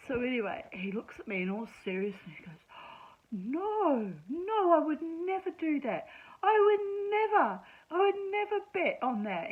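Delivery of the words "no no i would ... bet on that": indignant protest. Retold or imitated. Man protesting indignantly, high.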